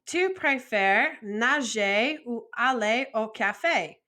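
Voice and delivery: slow, careful French question; careful French model. Shown as a demonstration. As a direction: model it slowly for the class. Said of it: "teacher models French phrase".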